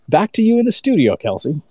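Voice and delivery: bright anchor handing back to studio; news anchor sign-off. Pretend. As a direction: toss it back like a TV reporter.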